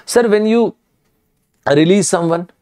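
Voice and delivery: ordinary talk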